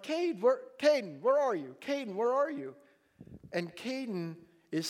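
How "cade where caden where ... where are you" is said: raised, higher calling voice. Retold or imitated. Father searching the house for his son, calling out. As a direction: call out for a missing child.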